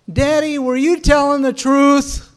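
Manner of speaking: put-on little girl calling out loud and high; real loud voice. Little girl to her dad getting out of the car, real loud voice. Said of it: "real loud voice".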